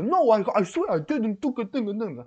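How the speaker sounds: hammed-up gangster swearing innocence; mock gangster. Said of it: "like a gangster".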